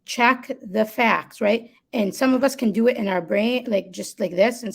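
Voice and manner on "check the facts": "Check the facts" said very slowly, each word weighted; calm, slow self-reminder. Shown as a demonstration. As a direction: spell it out slowly like a mantra. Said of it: "And then I say".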